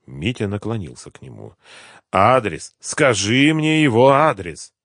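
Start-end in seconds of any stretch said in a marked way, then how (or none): 2.1-4.7 loud demand, slowing and rising; insistent demand. A narrator's reading. Gangster leaning over the beaten captive, insistent demand. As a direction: demand it, drawing out the second sentence.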